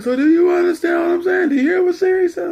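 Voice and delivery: very high, loud, rapid imitation of Dad; badgering dad. Dad pressing son after asking Siri, badgering. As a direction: hammer him with fast yelled questions.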